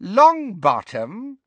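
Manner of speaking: high, slow, drawn-out question; high-pitched mocking query; gleeful villainess. A narrator's reading. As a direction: echo the name high and loud with wicked relish. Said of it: "repeated Bellatrix".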